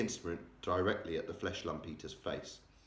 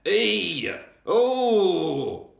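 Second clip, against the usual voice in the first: high shrieking roar; a sleeping giant wailing from a nightmare; giant's roar; 12 semitones higher, 11 dB louder than usual. A narrator's reading. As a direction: howl it out at full volume. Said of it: "roared the flesh lump eater".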